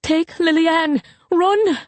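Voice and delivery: high, urgent cry to flee; panicked urging. A narrator's reading. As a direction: urge them away, pitch climbing high. Panicked mother orders daughters to flee.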